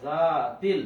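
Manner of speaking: জাতিল read as one with জা stretched; joined reading. Shown as a demonstration. Teacher joining the syllables for students, connected. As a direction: pull জা then run into তিল. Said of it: "মিলিয়ে পড়তে হবে".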